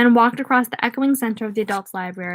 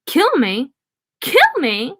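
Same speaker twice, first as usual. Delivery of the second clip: rising mock clown shriek; lightly voiced clown shriek; 4 semitones higher, slower than usual. A narrator's reading. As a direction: taunt it like a cackling clown. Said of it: "The clown shrieked".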